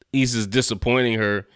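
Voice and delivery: usual speaking voice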